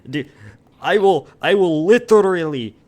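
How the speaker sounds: put-on heavy Slavic accent, shouted. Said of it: "Slavic accent".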